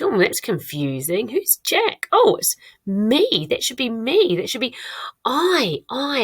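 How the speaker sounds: high-pitched puzzling turning to discovery; acted confusion, realisation. Teacher pretending to discover Jack is me, high surprised realisation.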